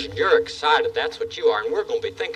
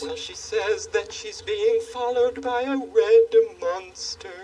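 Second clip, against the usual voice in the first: pompous king; 3 semitones higher, 4 dB quieter than usual. Acted. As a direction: lecture like a grand, fussy monarch. King relays a lady's message.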